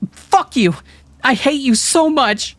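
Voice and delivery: very high, loud hateful yell; girl screaming hatred at her friends; furious outburst. A narrator's reading. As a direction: hurl it at them.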